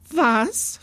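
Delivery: acted startled exclamation. A narrator's reading. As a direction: blurt it out in alarm. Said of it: "fragte Mama erschrocken".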